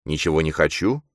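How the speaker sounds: plain reading voice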